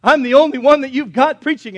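crying out. Acted. Elijah crying out to God as the last prophet, loud mock desperation. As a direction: wail out an acted plea at full volume. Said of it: "he's crying out to God".

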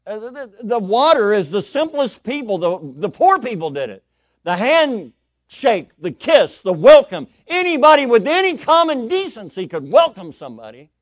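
very loud, high, impassioned preaching; full-force preaching. Real, not acted. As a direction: thunder it out to the congregation.

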